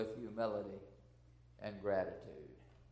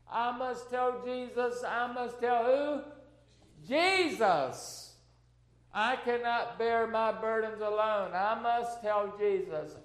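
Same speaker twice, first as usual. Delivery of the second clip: raised, fervent declaration of hymn words; emotional hymn recitation; 6 semitones higher, 7 dB louder, slower than usual. Real, not acted. Preacher reciting an old hymn to congregation, emotional. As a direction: press each repeated line home with feeling. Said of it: "excuse me if I get a little emotional".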